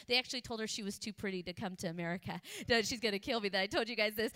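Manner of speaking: ordinary talk, nothing marked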